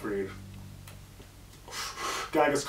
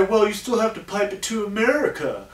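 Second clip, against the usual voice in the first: mocking voice; 7 semitones higher than usual. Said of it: "And people say".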